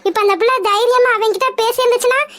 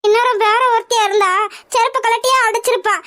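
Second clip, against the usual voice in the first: normal talking voice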